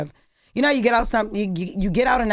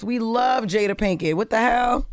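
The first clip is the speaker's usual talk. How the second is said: ordinary talk, nothing marked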